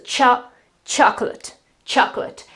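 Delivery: "chocolate" with stressed CHO, weak "lut"; stress on first syllable. Shown as a demonstration. Teacher demonstrates the accent, "CHOK-lut" stressed first. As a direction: hit the start of "chocolate", swallow the ending. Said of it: "the accent is the first syllable".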